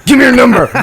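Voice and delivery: a strangled shouted demand; awkward scream. Retold or imitated. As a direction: yell the demand out of nerves. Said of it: "So I just kinda scream it at her".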